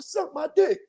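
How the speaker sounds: high-pitched comic comeback yell; mock angry retort. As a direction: throw it back at honking drivers. Retorting to honking drivers.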